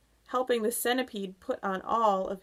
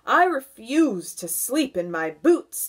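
loud, higher-pitched refusal; centipede's cry; 5 semitones higher, 8 dB louder than usual. A narrator's reading. Centipede refusing to sleep booted, loud cry. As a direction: protest with punched key words. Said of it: "the centipede cried".

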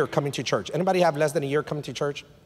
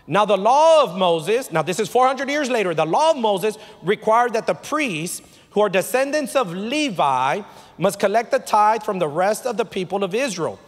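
higher-pitched, emphatic pulpit reading; insistent preaching voice, pitched up; preached scripture exposition; 6 semitones higher, 4 dB louder than usual. Acted. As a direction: declaim the law to the congregation at raised pitch.

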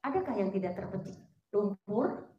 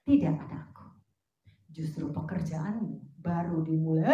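voice of God; 7 semitones lower than usual. Retold or imitated. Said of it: "Tuhan tuh cuma ngomong gini".

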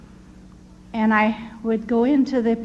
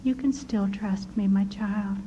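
soft tender whisper; hushed and tender reassurance; sweetly; 3 semitones lower, 6 dB quieter than usual. Retold or imitated. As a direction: breathe it gently like a comforting voice. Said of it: "And so sweetly he said".

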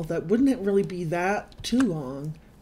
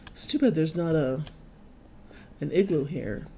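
normal talking voice

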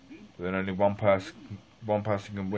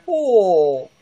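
high loud yelp of pain; sore muscles; 10 semitones higher, 10 dB louder than usual. Real, not acted. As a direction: yelp at a sharp twinge. Sudden pain while walking home.

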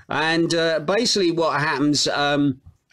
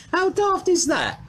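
high laughing how daft is that; real amused incredulity; laughing disbelief; 14 semitones higher than usual. Real, not acted. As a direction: laugh through the words at something absurd. Laughing at the community service rumour.

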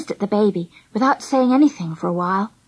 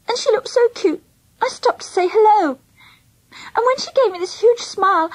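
high, plaintive tearful voice; tearful confession; 8 semitones higher than usual. A narrator's reading. Girl confessing she took a baby, tearful. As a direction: pour it out tearfully, pitched well up. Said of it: "blowing her nose".

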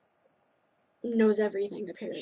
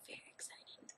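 soft whispered echo; 29 dB quieter than usual. Real, not acted. Repeating her excitement about the purchase, whispered. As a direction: whisper it to yourself. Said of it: "I'm very excited about that purchase".

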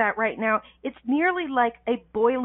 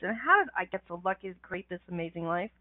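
ordinary talk, nothing marked

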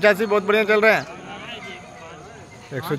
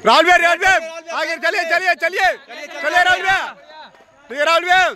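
cheering on; 6 semitones higher than usual. Real, not acted. Cheering a runner in race.

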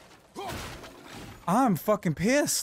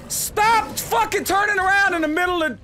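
higher, sharp exasperated outburst; frustrated yell; 9 semitones higher, 3 dB louder than usual. Real, not acted. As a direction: snap at the enemy in frustration. Frustrated at enemy dodging.

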